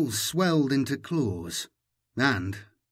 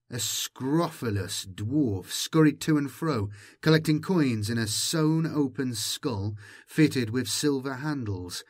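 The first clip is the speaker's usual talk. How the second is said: plain reading voice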